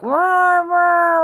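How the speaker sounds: high, loud mock whale call; whale cry like Dory. Talking about whales, imitating a whale cry. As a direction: wail it out like a cartoon whale. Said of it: "like Dory".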